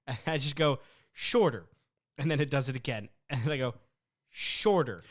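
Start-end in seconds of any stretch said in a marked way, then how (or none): 1.1-1.7 curt command. Retold or imitated. Acted command to the chatbot. 4.3-5.0 curt command. Retold or imitated. Acted repeated command to the chatbot.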